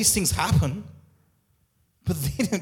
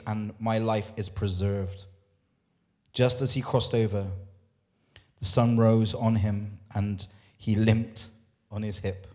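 1 scripture reading; 5 semitones lower than usual. Acted. Reads scripture passage.

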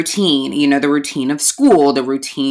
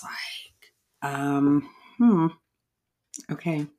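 soft, slow whispered dawning; whispering as the penny drops; hushed realization; 7 dB quieter, much slower than usual. Real, not acted. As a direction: let it sink in quietly. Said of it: "boom, it clicked".

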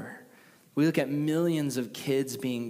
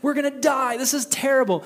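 high, loud panicked shouting; freaking out; 13 semitones higher, 9 dB louder than usual. Acted. Terrified disciples shout in the boat during the storm. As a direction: scream it like you're about to drown. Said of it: "All the other disciples are freaking out".

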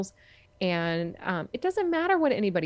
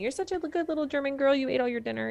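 higher, sing-song praising grandma voice; mimicking grandma; 6 semitones higher than usual. Grandma praising her for finishing dinner, mild higher sing-song mimicry. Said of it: "They say that to me".

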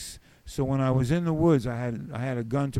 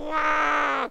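high shout of awe; 12 semitones higher than usual. Retold or imitated. Reacting to the returning flock.

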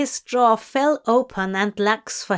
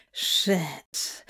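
low, softer muttered curse; 5 semitones lower, 6 dB quieter than usual. A narrator's reading. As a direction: mutter it under your breath. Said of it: "Crowley muttered again".

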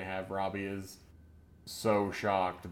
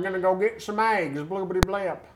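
put-on high, loud Southern country accent; 11 semitones higher, 11 dB louder than usual. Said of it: "big old thick country accent".

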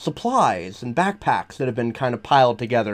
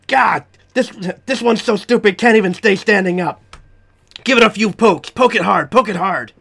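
loud, high-pitched jeering creature; rowdy monster mocking its captive; jeering captor; 5 semitones higher, 5 dB louder than usual. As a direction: taunt the prisoner, pitched up and loud. Game master voices jeering lizard captor.

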